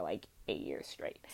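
conspiratorial whispered aside. Real, not acted. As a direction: drop to a secret whisper for the joke. Joke aside about endless pregnancies.

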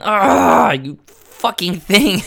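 loud exasperated growl-out at the cat, retold; pissed off. Man re-enacting grabbing his cat, pissed off. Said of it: "got really pissed off at my cat".

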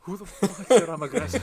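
mock furious Max. Friends riffing on Max's radio, laughing mock outrage. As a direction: ham up an indignant driver, laughing.

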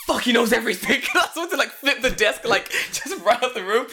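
high, loud outburst through laughter; comic panic. Retold or imitated. Teacher realizing student knows everything, laughing panic. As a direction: exclaim it, cracking up.

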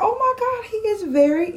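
high-pitched impressed gushing; excited gushing. Retold or imitated. Admiring reaction to a date's photo.

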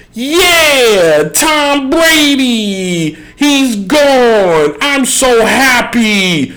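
high, long-drawn gleeful cheer; mock jubilation. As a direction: draw out each word in hammed-up celebration. Acts out rival teams celebrating departure.

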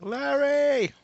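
high loud shout of the name. Pretend. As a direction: yell the name across the room. Calling out the name.

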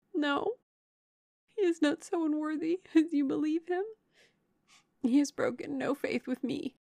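high, plaintive voice; distressed defence. A narrator's reading. Heartbroken sister defending Willoughby to Eleanor, distressed.

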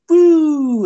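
loud, high whoop of joy on the sled; excited whoop. Retold or imitated. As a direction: shout it out like you're sliding downhill. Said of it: "So I went".

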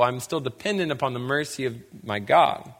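normal talking voice

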